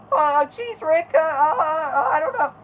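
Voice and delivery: put-on high stammering nervous boy; Morty impression. Streamer plays Morty answering Rick, high nervous stammer.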